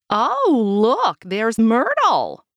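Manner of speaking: lightly voiced chatty old lady; gossipy grandma voice. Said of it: "Grandma Louise says".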